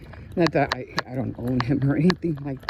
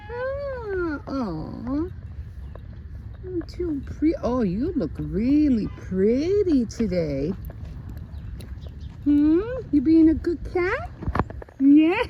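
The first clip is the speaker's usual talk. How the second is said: cooing at a cat; 10 semitones higher, slower than usual. Real, not acted. Admiring the pretty cat, tender high cooing.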